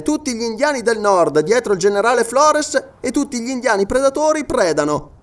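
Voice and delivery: high, projected sergeant's voice; sergeant reporting news. Acted. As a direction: report it emphatically, pitched above the narration. Sergeant's dialogue.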